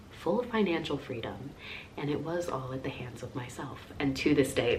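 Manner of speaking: ordinary talk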